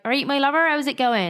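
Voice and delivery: light West Country greeting; friendly West Country accent, lightly done. Retold or imitated. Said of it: "we're from the West Country".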